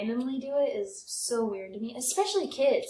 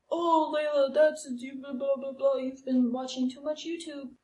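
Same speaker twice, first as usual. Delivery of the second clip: imitating dad; 3 semitones higher than usual. Daughter mimics her dad dismissing alien talk. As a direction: brush her off like a weary dad. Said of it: "Sure, whatever".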